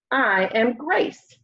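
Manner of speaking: ordinary delivery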